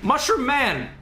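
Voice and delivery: high, loud cry of alarm; sudden high-pitched yelp of recognition; startled shout. Real, not acted. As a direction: yell out as an enemy suddenly appears. Startled by enemy mid-game.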